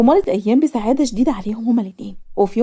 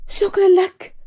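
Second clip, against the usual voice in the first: high-pitched grateful thanks; happy; 9 semitones higher, slower than usual. A narrator's reading. Recovered patient thanks her doctor, happy. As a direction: lift the voice high with acted happiness. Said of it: "بصيت له بسعادة".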